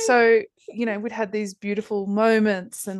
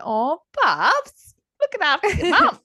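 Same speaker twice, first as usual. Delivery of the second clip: much higher and louder, cooing to her son; sing-song mum voice; 10 semitones higher, 6 dB louder than usual. Retold or imitated. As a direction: coo it up high at Bubs. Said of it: "And I'm like".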